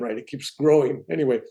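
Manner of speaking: ordinary delivery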